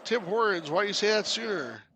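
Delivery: sudden high, loud delight; excited. Acted. Character thrilled to hear about Tim Hortons, high and loud. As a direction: perk up at the name Tim Hortons.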